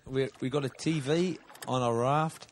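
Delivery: hammed-up Aussie podcast host; put-on Australian accent. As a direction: play a laid-back Australian bloke introducing the show.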